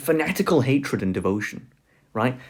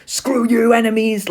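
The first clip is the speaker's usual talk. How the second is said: higher, louder hammed-up booing mob; mock crowd booing; 9 semitones higher, 4 dB louder than usual. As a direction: jeer like a fanatic crowd at a screen. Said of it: "they go crazy".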